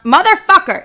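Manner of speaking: a startled yell at the bug; real outburst. Real, not acted. Host swatting a bug mid-episode, sudden loud curse.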